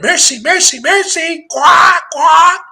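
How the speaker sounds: very high, loud squawking parrot; mock parrot. Preacher mimics follower as a mindless parrot, mocking squawk. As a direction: parrot the word mindlessly, rising into shouted quacks. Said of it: "I'm a parrot".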